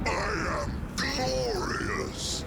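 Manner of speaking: high, slow triumphant cry; a grandiose emperor's shouted boast; exultant boast. Acted. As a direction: crow it to the heavens.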